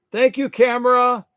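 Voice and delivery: playful thanks. Real, not acted. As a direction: cheerfully thank the camera.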